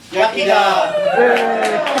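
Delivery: loud, slow, high rallying cry; triumphant shouted cry. Acted. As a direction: bellow it as a war cry. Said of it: "shouted rallying cry".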